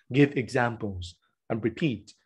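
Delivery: normal talking voice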